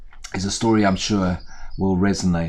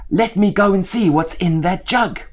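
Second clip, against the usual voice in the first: high, louder crow voice; an eager bird heading down; curious crow; 12 semitones higher, 6 dB louder than usual. Acted. Crow speaks.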